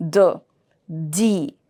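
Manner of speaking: D with a soft French attack. Shown as a demonstration. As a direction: say "D" gently, low, as in "dee".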